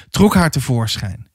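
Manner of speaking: ordinary delivery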